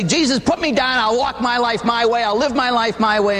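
high, whining protest; hammed-up whiny sinner; whiny demand. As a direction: demand it like a petulant child.

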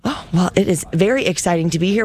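normal talking voice

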